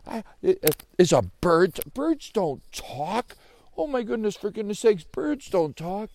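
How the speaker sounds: high, stammering astonishment; incredulous out loud. Acted. Joey insists birds don't talk, incredulous. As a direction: protest loudly, high in pitch, dragging out the ends. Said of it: "he said out loud".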